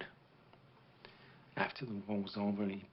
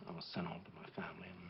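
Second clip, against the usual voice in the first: fast soft whisper; 2 semitones lower, 9 dB quieter, much faster than usual. Acted. Veteran to boy, fast whisper. Veteran ends his story.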